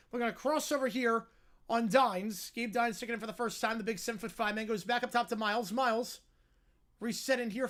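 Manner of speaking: brisk, projected basketball commentary; live play-by-play. Real, not acted. Calling a basketball possession for listeners, live play-by-play. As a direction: follow the ball down the court, punching key words.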